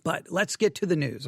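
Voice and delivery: ordinary talk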